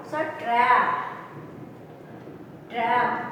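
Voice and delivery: TRAP as "tryap", vowel held; ya sound in trap. Shown as a demonstration. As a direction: say TRAP with a ya glide, falling. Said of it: "R and A becomes ya sound".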